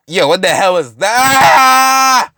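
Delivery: high, loud shout ending in a long scream; terrified scream. Acted. Victim in the mist, terrified acted scream. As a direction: shout it and scream out the last word.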